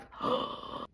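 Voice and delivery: sharp whispered gasp of shock. Retold or imitated. Friend seeing the swollen ankles, audible shocked gasp. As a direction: suck in air in dismay.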